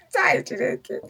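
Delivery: bursting into high laughter; laughing. Real, not acted. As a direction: laugh out at the memory. Laughing at her own story.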